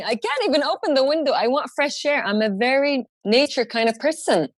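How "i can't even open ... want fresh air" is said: higher-pitched exasperated complaint; fed-up plea for air; mildly annoyed whine. Retold or imitated. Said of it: "That annoyed me".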